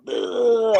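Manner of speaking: high strained grunt on the pull-up bar; straining grunt. Retold or imitated. Kid failing a pull-up in front of his dad: high strained grunt. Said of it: "he's like".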